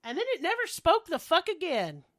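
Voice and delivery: usual speaking voice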